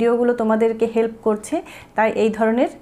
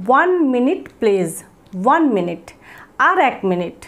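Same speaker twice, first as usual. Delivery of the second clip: slow model phrase; slower than usual. Shown as a demonstration. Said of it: "ঠিক আছে".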